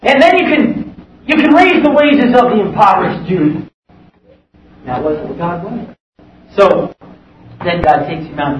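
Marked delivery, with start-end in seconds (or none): 0.0-3.6 high, mocking scoff at Moses; scoffing girlfriend. Girlfriend scoffing at Moses in the mud pits, high mocking voice. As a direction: sneer at him to go rule Egypt. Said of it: "laughing at him, scoffing at him".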